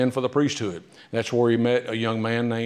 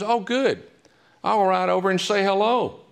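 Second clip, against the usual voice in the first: much higher, sing-song cheerful lady; cheery Mary Ord; 9 semitones higher than usual. Retold or imitated. Speaker voices Mary Ord riding over to greet, high chirpy. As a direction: chirp it brightly and naively.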